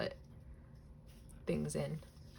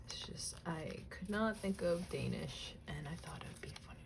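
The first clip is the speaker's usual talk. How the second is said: unvoiced, much softer, whispered aside; 7 dB quieter than usual. Real, not acted. Admitting to viewers she couldn't think of Danish, whispered. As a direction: confess it under the breath. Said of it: "explains the joke in a whisper".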